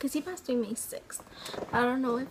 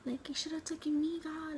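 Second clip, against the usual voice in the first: high, pleading wail of a grieving mother; retold grief, voice lifted high; imitating mom's crying; 5 semitones higher than usual. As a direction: echo mom's cry to God, pitched up and raw. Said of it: "she was yelling at God".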